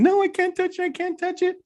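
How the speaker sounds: very high imitation of a panicked child; mock freak-out. Boy refuses to touch the banana with the ant, high mock panic. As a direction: squeal the refusal like a terrified kid. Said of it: "you're freaking out over an".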